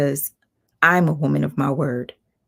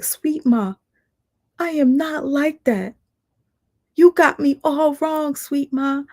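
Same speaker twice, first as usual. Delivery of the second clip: much higher, louder sugary mock voice; mocking imitation; 11 semitones higher, 4 dB louder than usual. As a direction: mimic her fake sweetness. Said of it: "she'd be like".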